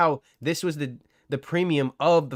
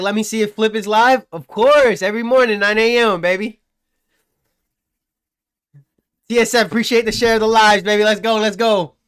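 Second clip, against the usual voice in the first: louder, higher, buzzing with energy; hyped shoutout; 5 semitones higher, 5 dB louder than usual. Real, not acted. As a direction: pump up the chat.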